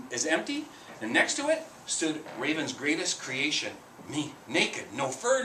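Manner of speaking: ordinary delivery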